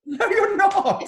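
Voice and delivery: very high, loud, drawn-out protest; real disbelief. Real, not acted. Singer refusing to believe fan is Australian, incredulous. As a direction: squeal it in laughing disbelief.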